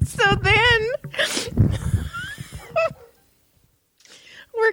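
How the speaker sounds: high, laughing so hard it squeaks. Real, not acted. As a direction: crack up mid-sentence. Laughing at the story.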